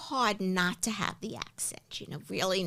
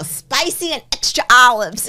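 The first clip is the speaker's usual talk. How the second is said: punchy, louder retelling of an order; emphatic order; 8 dB louder than usual. Retold or imitated. Guest ordering her Bloody Mary, emphatic. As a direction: tell the bartender with relish, extra loud. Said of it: "I go".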